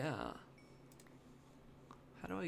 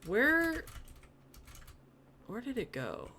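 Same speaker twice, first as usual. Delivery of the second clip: startled; 9 semitones higher, 5 dB louder than usual. Real, not acted. Item vanished into machine.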